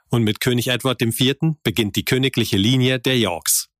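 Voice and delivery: normal talking voice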